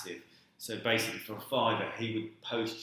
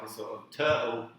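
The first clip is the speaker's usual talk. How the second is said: ordinary talk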